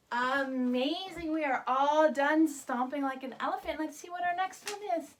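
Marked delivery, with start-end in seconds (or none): none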